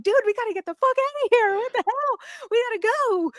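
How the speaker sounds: very high, rushed squeal of retold alarm; mock panic. Speaker retelling her plea to a friend to flee, mock panic.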